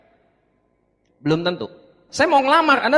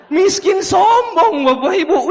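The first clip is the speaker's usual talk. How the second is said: higher, louder, slow outburst; indignant shout; 10 semitones higher, 7 dB louder, slower than usual. Real, not acted. Presenter to audience, shouting at a broke but arrogant prospect. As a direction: let the outrage burst out.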